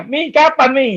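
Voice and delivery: lightly voiced impatient young man asking when; pleading son. Young man asking his mother when his dream will come, mild pleading. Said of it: "Saya tanya mami saya".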